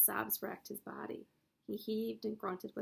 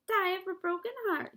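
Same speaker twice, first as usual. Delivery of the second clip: high, loud mocking mimicry; mock his words back in a silly voice; mimicking; 10 semitones higher, 9 dB louder than usual. The rat mimicking Wilbur's heartbreak, high and loud. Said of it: "he mimicked".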